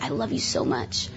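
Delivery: hushed, breathy devotion to Jesus; soft, lovesick prayer under the breath; tender whisper. Retold or imitated. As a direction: whisper it like a love confession. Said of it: "I was all tender".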